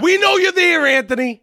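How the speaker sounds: high, loud teasing shout; mock call-out. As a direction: call out to him as if he's listening nearby. Calling out to neighbour Anthony.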